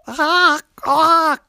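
high, loud squawking crow; a crow answering with loud caws. A narrator's reading. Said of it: "It's kinda how crows sound".

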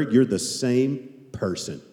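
usual speaking voice

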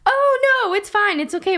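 put-on high, quick child's fib; fibbing little girl. Girl assuring her friend's dad, in a high fibbing child voice.

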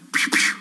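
little spoken "pew" gun noises; playful shooting sound effect; imitated gun shots. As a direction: mimic a toy gun firing twice.

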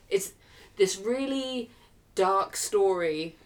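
normal talking voice

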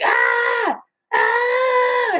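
put-on high shrieking wail like a toddler; toddler scream. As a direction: shriek it high like a baby refusing to swim. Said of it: "he's like".

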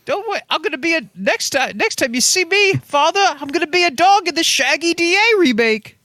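high creature voice; high-pitched, eager little blob; Flubber. Acted. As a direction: promise it in a squeaky put-on voice. Flubber creature keeps talking to Father.

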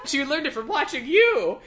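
high-pitched, hammed-up blaming; mock accusation. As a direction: throw the blame as an overwrought mom. Cohost continues acting the mother's outburst.

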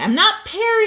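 high, loud protest; loud high-pitched insistence; insistent denial. Real, not acted. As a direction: push back hard on the claim.

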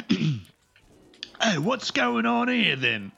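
high, slow, far-off troll voice; Hobbit troll voice. Acted. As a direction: play a dim troll checking the room. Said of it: "let's go with the trolls from, from The Hobbit".

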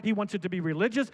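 normal talking voice

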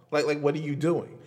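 ordinary talk, nothing marked

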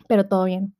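ordinary talk

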